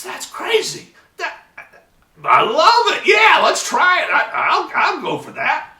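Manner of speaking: high, loud and thrilled, shouting with joy; excited. Retold or imitated. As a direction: burst out like he can't believe it. Excited client on the phone.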